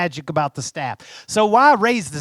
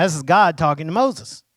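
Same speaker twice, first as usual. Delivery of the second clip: usual speaking voice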